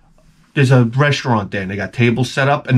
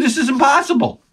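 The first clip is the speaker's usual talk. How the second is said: much higher amazed exclaim; higher wide-eyed this is impossible; astonished; 12 semitones higher than usual. Retold or imitated. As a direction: marvel at the easy deal. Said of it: "I said".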